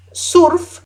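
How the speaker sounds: surf said high, with a clear u and r. Shown as a demonstration. Teacher showing the right Spanish surf. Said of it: "sino surf".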